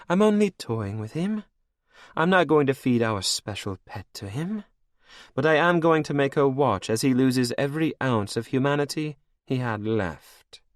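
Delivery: high, cold and mocking; villainess queen. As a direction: purr it over your captive like a cruel queen. Anne taunts Cashel.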